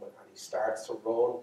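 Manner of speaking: ordinary delivery, nothing marked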